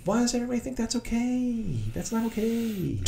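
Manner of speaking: high mock protest. Friend reacting to lockdown talk, high soft mock dismay.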